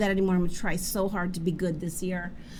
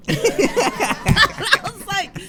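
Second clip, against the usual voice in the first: high, slow words through laughter; laughing; 10 semitones higher, 5 dB louder, much slower than usual. Real, not acted. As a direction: laugh as you say it. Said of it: "I'm laughing".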